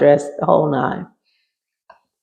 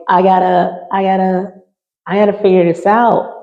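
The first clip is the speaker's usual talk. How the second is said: higher, rising, a touch of panic; anxious; 4 semitones higher than usual. Retold or imitated. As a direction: push through the worry. Retold panic in hotel.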